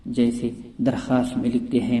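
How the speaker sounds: ordinary delivery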